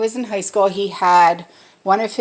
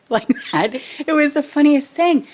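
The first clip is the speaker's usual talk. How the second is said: ordinary delivery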